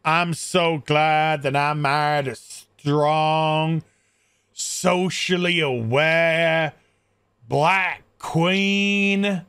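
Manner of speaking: slow, drawn-out proclamation of devotion; gushing husband. Retold or imitated. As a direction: stretch every phrase out with mock solemnity. Voicing a husband praising his wife.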